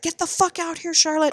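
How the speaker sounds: high, held-back angry holler; mock yell. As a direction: imitate yelling without the volume. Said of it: "yelling her name".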